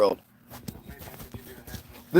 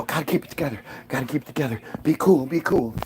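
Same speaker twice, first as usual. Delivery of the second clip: nervous self-talk; 5 semitones lower, 6 dB quieter, faster than usual. Retold or imitated. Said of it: "you're just like".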